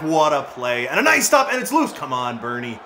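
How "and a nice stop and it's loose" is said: much higher, louder excited call; high excited rush. Real, not acted. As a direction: call the loose puck urgently. Rebound in front.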